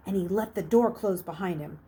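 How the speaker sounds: ordinary delivery, nothing marked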